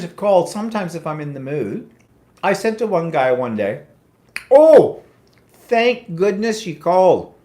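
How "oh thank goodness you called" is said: mock delight. Mocking the duct cleaning caller.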